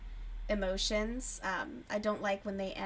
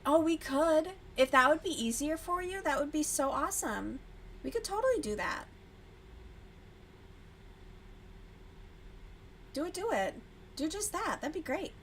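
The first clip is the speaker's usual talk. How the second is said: higher, bright enthusiasm; eager; 6 semitones higher than usual. Real, not acted. Agreeing to a Saturday TV show night, eager and higher. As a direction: sell the idea eagerly.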